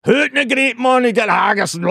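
high, hammed-up Scottish accent; cheery fake Scottish morning call; mock Scottish accent. Comic mocks a Scottish breakfast in a hammed-up Scottish accent.

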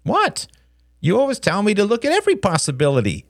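high, quick protest; rapid, high-pitched backtalk; cheeky boy. Acted. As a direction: answer back as a smart-aleck kid. Cheeky son answers back.

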